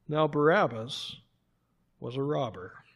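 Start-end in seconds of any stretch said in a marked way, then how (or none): none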